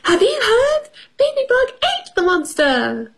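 high-pitched excited announcement; small character's mock shout; storybook shout. A narrator's reading. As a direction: call out the news high, without much extra volume. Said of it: "He shouted".

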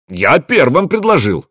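high, mildly indignant objection; indignant protest. A narrator's reading. Young warrior objects to being passed over, indignant protest. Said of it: "возмутился медвежонок".

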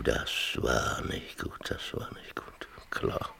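whispered, barely there; stunned whisper. Acted. Father whispering the teacher's words back to himself, stunned. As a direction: murmur it to yourself, shaken.

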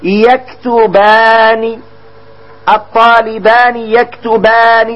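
يكتبان and الطالبان يكتبان stretched syllable by syllable; slow teaching drawl. Shown as a demonstration. Teacher modelling the dual verb, slow and drawn out. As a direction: draw the dual ending out slowly so students hear it. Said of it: "تقول".

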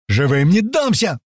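high, slow defiant yell; hollering. Acted. Паштет struggles against the men holding him, hollering. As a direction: holler it defiantly, words stretched. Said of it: "вопит во всё горло Паштет".

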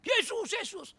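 high-pitched cry to Jesus; imitating a panicked believer's cry; shouted prayer. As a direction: call out in mock desperate prayer. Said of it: "saya berdoa teriak".

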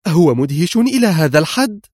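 high, amazed woman's voice; awestruck question. A narrator's reading. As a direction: ask in wonder, voice lifted. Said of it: "قالت بأنفاس مبهورة". (سؤال منبهر بصوت مرتفع)

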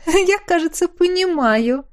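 high giggling delight. Acted. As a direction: giggle through the words. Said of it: "заливисто хихикнула женщина".

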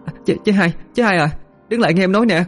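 calling after her. A narrator's reading. Younger sister calls after fleeing sister, high fast call. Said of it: "Cúc liên tục gọi với theo".

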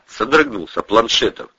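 usual speaking voice

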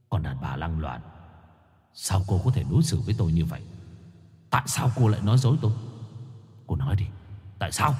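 husband's cold fury in an argument; quarrelling husband. A narrator's reading. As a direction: interrogate the wife you think betrayed you. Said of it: "tựa như cãi nhau".

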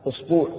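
أسبوع as "usbuu'", with u first and long u; teacher's demo of أسبوع as usbuu'; tenth variant form. Shown as a demonstration. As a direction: say أسبوع with a drawn second vowel, "us-BOO'". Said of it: "فيه لغة عاشرة".

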